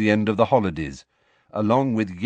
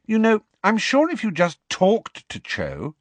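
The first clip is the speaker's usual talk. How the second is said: put-on high-pitched girl giving advice; Ginny, encouraging; 11 semitones higher than usual. A narrator's reading. Ginny advising Harry to talk to Cho, encouraging. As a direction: nudge a friend in a high girl's voice.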